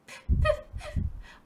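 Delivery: high whispered whimper; breathy whimper. A narrator's reading. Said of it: "whimpered the fox".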